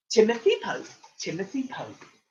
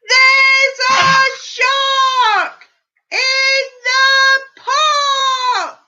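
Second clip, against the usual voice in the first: high, loud, slow drawn-out chant; shout; 14 semitones higher, 13 dB louder, much slower than usual. Acted. Reader leading the children in Timothy's big shout. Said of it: "What do you think he's about to shout?".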